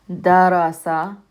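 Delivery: Darasa spoken slowly, each short a separated; slow short vowel demo. Shown as a demonstration. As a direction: spell out Darasa syllable by syllable. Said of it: "They're short vowel sounds".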